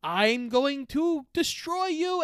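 high, slow, drawn-out villain threat; Ronan. Retold or imitated. Ronan threatening the heroes with his hammer, mock menace. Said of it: "He's about to be like".

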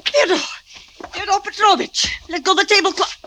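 alarmed cries. Acted. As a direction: cry out in shock at the body. Maid finds man in closet.

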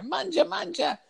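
put-on high old Italian grandma calling "manja"; grandma offstage urging food. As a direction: croon it like a nonna calling kids to eat. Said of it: "that sounds like my grandma".

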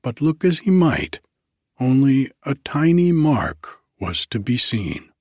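plain reading voice

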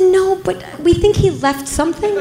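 high, tentative, questioning tone; delicate news-breaking. Retold or imitated. Officer telling victim about the burglar's leftover, awkwardly tentative. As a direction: break it gingerly with pitch held high.

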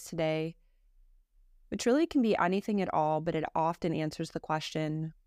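calm, measured meditation voice with long pauses; guided meditation. Acted. Teacher leading a listener through Yoga Nidra practice, guided meditation. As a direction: guide them unhurriedly, settling each phrase into silence.

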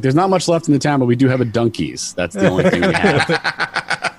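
put-on thick Boston accent, laughing at the end. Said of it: "sound like a character in The Departed".

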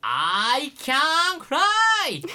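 stretched-out ecstatic cry; triumphant shout. Acted. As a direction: soar as you yell it. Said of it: "繰り返しつぶやくのです".

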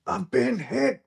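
hammed-up wounded cry, high; dramatic "I've been hit" yelp; overacted death scene. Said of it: "Steve yelled out".

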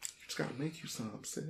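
hushed, mock-tender comfort; soft consoling. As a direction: gently soothe a disappointed friend. Consoling friend who misses honey butter.

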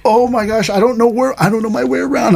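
high, worried mock panic; imitating own panic, high and anxious; panicked inner voice. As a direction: fret aloud like a lost driver. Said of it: "And I was like".

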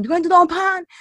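loud, high-pitched shouted question; imitated yell. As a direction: holler it like a stranger from a car. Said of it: "he yells at me".

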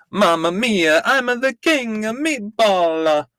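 high, hammed-up Italian accent. Host announcing the episode title, mock Italian accent. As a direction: play it as a cartoonish Italian accent. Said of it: "please say in Italian accent".

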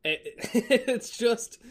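laughing. Real, not acted. Laughs at crazy sights.

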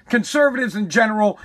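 ordinary delivery

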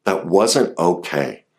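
ordinary talk, nothing marked

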